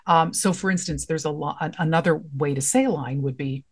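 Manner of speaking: ordinary delivery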